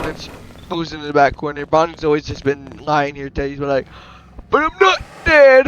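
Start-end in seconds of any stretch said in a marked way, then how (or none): 4.5-5.7 loud, high-pitched outburst; indignant protest. Acted. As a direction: cry it out in mock outrage. Toy protests in acted story.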